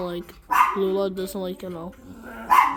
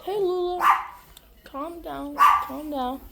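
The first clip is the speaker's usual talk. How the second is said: high and softer, calming; soothing the dog; 6 semitones higher, 4 dB quieter, slower than usual. Real, not acted. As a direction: hush her down tenderly.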